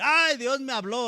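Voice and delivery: high-pitched, hammed-up cry of revelation; showy exclamation. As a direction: exclaim it high for everyone to hear. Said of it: "no te importó decirlo ahí en voz baja, tampoco decir".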